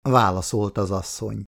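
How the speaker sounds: plain reading voice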